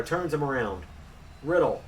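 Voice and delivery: usual speaking voice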